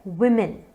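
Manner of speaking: वुमेन as "wim-in"; short i in the first syllable of वुमेन; correct pronunciation. Shown as a demonstration. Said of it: "इसका pronunciation है". (वुमेन को "विमिन" बोलें)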